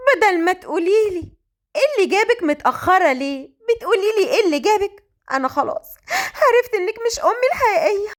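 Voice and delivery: high-pitched, hammed-up wounded protest; mock-wail it high, like a wronged daughter; whining daughter. A narrator's reading. Malak protests her mother's cold greeting, high melodramatic whine.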